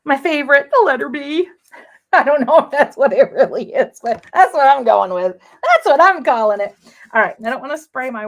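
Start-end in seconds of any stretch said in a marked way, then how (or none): 1.8-6.8 loud, amused talk through a laugh. Real, not acted. Host defending her made-up name to viewers, laughing. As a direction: laugh it off and insist anyway.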